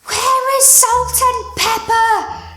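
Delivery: high, drawn-out wail, almost sung; retold scream. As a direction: mimic a frantic scream from upstairs, stretching the words. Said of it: "we heard a scream coming from upstairs".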